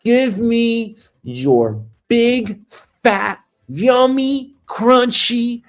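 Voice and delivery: high, drawn-out hungry order; relishing demand. Acted. Customer ordering a French cruller at the bakery, hammed-up relish. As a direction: stretch each adjective high with hammed-up craving.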